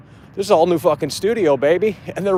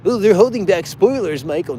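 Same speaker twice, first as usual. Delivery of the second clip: higher whiny mock voice dropping away on Michael; hammed-up fan excuse; mocking fanboy; 5 semitones higher than usual. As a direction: ridicule the excuse in a fan's voice. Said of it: "how is that a positive?".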